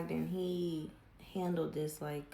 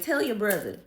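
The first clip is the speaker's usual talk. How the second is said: imitating dad's loud demand; loud, commanding father; stern order; 4 semitones higher, 8 dB louder than usual. Dad orders her to confess.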